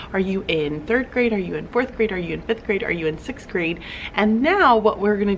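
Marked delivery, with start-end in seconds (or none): none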